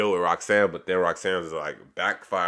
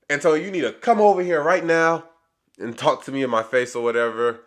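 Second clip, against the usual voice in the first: higher-pitched bossy demand, imitating her; retold angry summons; 6 semitones higher than usual. Roxanne summoning Antonio to face her, retold as an angry demand. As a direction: order him over, mock-indignant and raised in pitch.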